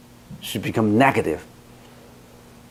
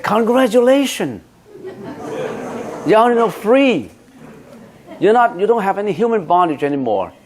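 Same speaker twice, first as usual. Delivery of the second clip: high, bright-pitched joyful congratulating; cheery congratulations; 8 semitones higher than usual. Retold or imitated. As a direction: cheer her freedom like good news. Retold cheerful congratulations to divorcee.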